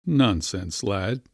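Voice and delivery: usual speaking voice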